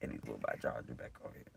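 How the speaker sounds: throwaway aside to self; muttered aside. Real, not acted. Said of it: "muttered aside".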